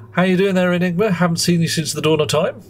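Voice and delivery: high, bright sing-song hello; cheery greeting. Real, not acted. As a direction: greet a friend warmly and high. Greeting a returning viewer.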